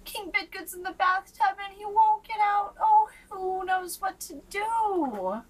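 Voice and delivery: high, loud fretful page voice; young page fretting about the king; worried page. A narrator's reading. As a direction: plead for help, anxious and high. Page's worried plea.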